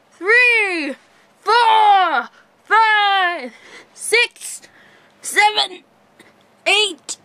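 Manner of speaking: high, loud, effortful count between push-ups; straining push-up count. Real, not acted. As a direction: count each push-up through real exertion. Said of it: "I'll prove it to you".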